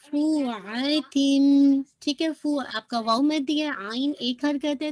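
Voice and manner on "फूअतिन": फूअतिन loud and very slow, fu held; two-count stretch. Shown as a demonstration. Teacher models the stretch, slow recitation. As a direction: stretch फूअतिन with a long hummed ending. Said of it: "टू count आपने stretch करना".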